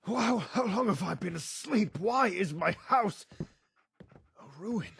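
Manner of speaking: panicked questions. Acted.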